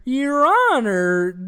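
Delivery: slow, grand courtroom address; TV lawyer. Retold or imitated. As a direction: play it like a TV lawyer. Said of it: "T V lawyers".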